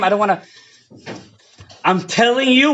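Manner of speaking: normal talking voice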